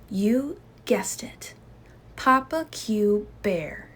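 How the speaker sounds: plain reading voice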